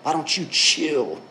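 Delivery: slow, easy, unbothered reply; God shrugging off the worry, slow and relaxed; laid-back God. Pretend. God answering the anxious believer, slow laid-back reassurance. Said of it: "And God's saying".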